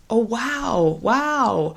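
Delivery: bright, lifted oh wow; positive surprise. Shown as a demonstration. Tutor shows a happy response to the patient's news. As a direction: sound pleased by good news. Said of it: "if it's more positive".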